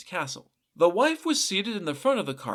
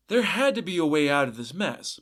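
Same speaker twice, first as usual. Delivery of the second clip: ordinary delivery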